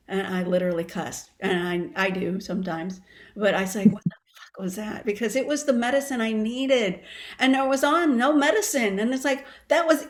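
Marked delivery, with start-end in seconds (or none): 3.9-5.1 hushed disbelief, almost no voice; shocked whisper. Retold or imitated. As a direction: whisper it, stunned and breathless. Retelling a shocked first breath.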